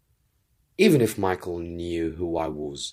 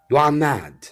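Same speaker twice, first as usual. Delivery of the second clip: protesting; 7 semitones higher, 7 dB louder than usual. A narrator's reading. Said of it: "I said to Zopt".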